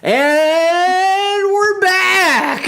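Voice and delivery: long, high sung-out holler; mock show-host shout. Announcing the show's return, mock high drawn-out shout. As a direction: stretch it out high like a hammy announcer.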